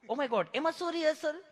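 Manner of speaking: high, surprised Oh my God; mock surprised city lady. A city visitor, amazed, asks why she needs a rehearsal. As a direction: play the startled city lady.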